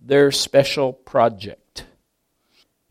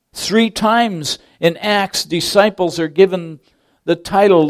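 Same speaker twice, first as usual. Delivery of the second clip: normal talking voice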